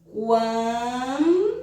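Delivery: "Quando" with Quan held long through the nose, rising, then a separate do; "Quando" split in two, humming the first syllable; nasal syllable stretch. Shown as a demonstration. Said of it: "precisa de uma esticada pela narina".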